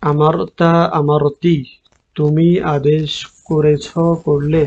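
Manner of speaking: slow dictation, word by word; slow teacher dictation; slowly, on request. Shown as a demonstration. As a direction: stretch each word so they can copy it. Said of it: "আস্তে আস্তে বলেন".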